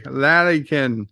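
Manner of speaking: "Larrykin" in three syllables, LA-ree-kin; corrected pronunciation. Shown as a demonstration. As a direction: slowly sound out "Larrykin", with "ee" in the middle. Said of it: "That's how it sounds".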